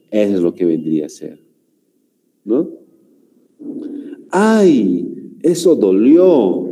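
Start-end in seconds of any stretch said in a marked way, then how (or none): 4.3-6.7 high "ay", then drawn-out complaint; mock pain. Shown as a demonstration. Teacher giving an example of interjections, acting out pain. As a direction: cry out as if hurt, stretching the words. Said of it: "cuando quiero enfatizar lo que es un dolor".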